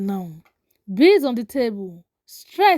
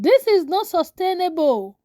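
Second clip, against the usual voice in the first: higher-pitched angry objection; mildly angry; 6 semitones higher than usual. A narrator's reading. Adewale quarrels with Lala over money, mildly angry. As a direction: object with a touch of anger. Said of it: "Ademo angry now".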